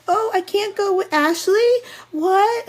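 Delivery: high, whiny mock surprise; squeaky mock dismay at being split up; mock confused rider.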